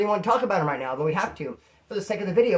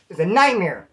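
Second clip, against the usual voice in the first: usual speaking voice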